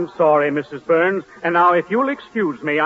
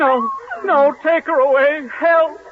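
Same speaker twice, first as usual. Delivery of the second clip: much higher panicked yelps; comic panic; 8 semitones higher than usual. Acted. Higginbottom begs to be rescued from Tootsie, high panicked squeal. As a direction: squeal for help, terrified.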